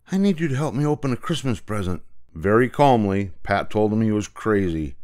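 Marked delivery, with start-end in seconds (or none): 0.1-2.0 breathless. A narrator's reading. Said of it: "he said breathlessly".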